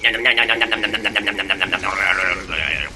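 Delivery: low, fading nom-nom chant; baby sharks munching. Acted. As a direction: chomp greedily then fade out.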